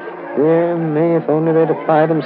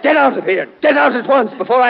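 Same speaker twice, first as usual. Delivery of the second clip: higher, rising angry order; 10 semitones higher, 3 dB louder, faster than usual. Acted. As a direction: drive him out of the room. Teacher angrily throws boy out.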